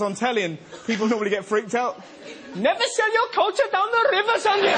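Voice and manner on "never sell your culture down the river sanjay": high hammed-up Indian accent; Indian cow. Indian cow admonishing another cow, mock Indian accent. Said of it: "I'm an Indian cow".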